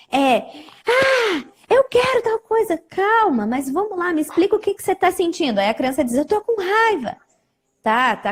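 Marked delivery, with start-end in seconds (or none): 0.9-2.8 put-on high, pleading child voice; high-pitched little kid begging. Therapist voices desperate child. 2.9-5.6 higher, soothing voice, speeding up at the end; coaxing tone for a small child; calming a child. Retold or imitated. 6.1-7.2 put-on high little-kid voice saying it's angry; high-pitched child admitting anger. Child answers the therapist.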